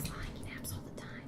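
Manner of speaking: hushed teasing whisper. Real, not acted. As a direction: whisper it as a jab. Whispered tease.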